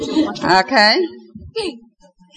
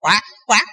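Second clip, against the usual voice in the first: nasal duck quack call; 2 semitones lower than usual. Shown as a demonstration. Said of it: "making a duck sound like this".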